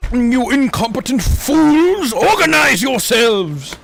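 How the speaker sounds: slow, loud, commanding rage; villain lord voice. Pretend. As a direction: bellow at your minions. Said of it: "my inner freaking Game of Thrones".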